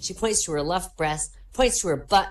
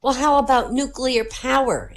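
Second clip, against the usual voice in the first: put-on high, louder little-girl voice; 9 semitones higher, 5 dB louder than usual. Girl suggesting a topic to stranger, high little-girl voice. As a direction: propose it as a little girl, pitched up. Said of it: "asked the girl".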